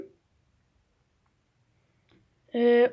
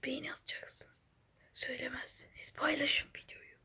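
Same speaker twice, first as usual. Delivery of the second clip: hushed conspiratorial whisper to the viewers; barely voiced pleading whisper; secret whisper; 3 semitones lower, 16 dB quieter than usual. Long whispered secret plea.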